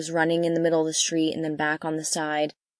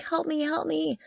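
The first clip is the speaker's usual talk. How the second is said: higher, softer little pleading voice; lamb's plea; 8 semitones higher, 6 dB quieter than usual. Retold or imitated. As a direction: voice the lost lamb crying for help. Voicing the crying lamb.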